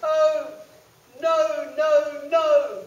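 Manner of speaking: high, loud piggy squeal; high loud panicky pig; squealing little pig. Acted. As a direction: squeal it out like a frightened piglet. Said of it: "oinks the little pig".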